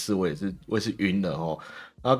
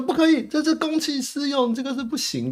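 much higher scolding mock voice; mock prim councilor. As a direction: play the fussy hall monitor. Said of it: "他说".

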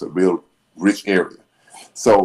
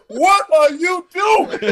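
laughing mock shout; much slower than usual. Retelling the attendant's yell as a punchline, high laughing shout. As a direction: repeat the startled yell while laughing. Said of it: "it's kind of the same thing".

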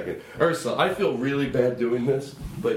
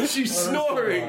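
amused coo; 9 semitones higher, much slower than usual. Real, not acted. Cooing over sleeping dog.

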